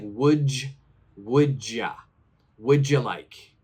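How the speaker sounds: "Would you" squeezed to "wouldja"; chunked together. Shown as a demonstration. Teacher modelling natural café speech, joined words. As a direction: let "would ya" run together. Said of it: "We say it almost like".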